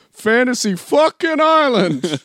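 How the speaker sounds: long, high, loud triumphant yell; over-the-top ecstatic shout; excited scream. Acted. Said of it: "will scream".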